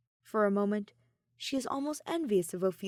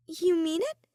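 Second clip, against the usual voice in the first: high, louder, hopeful question; 10 semitones higher, 6 dB louder than usual. A narrator's reading. Ophelia hoping Soleil means it, high and hopeful. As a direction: ask as if hardly daring to believe.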